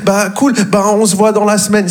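high, breezy brush-off; breezy caller. Retold or imitated. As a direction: hang up breezily. Caller quoted ending call. (fin d'appel désinvolte, voix aiguë)

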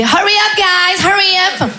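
high, slow hammed-up English calls; mock mother in bad English. Mother calling out high to strangers in the supermarket. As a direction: mum showing off her fake English in the shop. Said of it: "elle voyait des gens passer et elle faisait".

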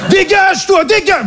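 put-on high-pitched Parisian-accented outburst; angry mock Parisian. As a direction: send someone packing as an irate caricature Parisian. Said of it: "qui sonne le parisien de base". (accent parisien imité, aigu et agressif)

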